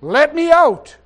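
loud falling cry to be let out; Spirit crying out. Acted. Preacher voicing the Spirit inside him, loud cry. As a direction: call out like a voice trapped inside. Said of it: "of him saying".

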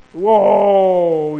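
long, loud, drawn-out whoa; acted dismay. Jacob reacting to bad news, acted dismay. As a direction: wail it out as the dismayed father.

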